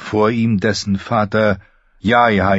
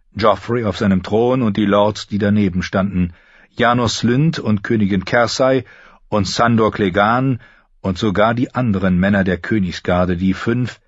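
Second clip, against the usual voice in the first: ordinary delivery, nothing marked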